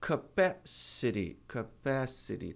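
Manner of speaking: "capacity", the T flapped, said slowly; careful demo of "capacity" with a flapped T. Shown as a demonstration. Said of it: "Esse aqui é capacity".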